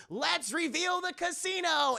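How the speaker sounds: slow, high presenter's flourish; grand unveiling. Retold or imitated. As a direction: announce it like a big reveal. Casino owner unveiling to a crowd.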